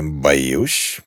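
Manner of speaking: low, lazy drop; lazy objection. A narrator's reading. Duke answers the shouting girl, low and lazy. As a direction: counter it without any effort. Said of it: "лениво возразил герцог".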